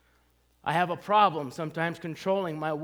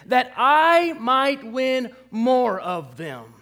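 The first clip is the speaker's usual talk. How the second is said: that I might win more of them said very slowly and louder; slow, weighty scripture emphasis; slow emphatic reading; 4 semitones higher, 5 dB louder, much slower than usual. Acted. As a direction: lean on every word of the verse. Preacher stresses verse after listen.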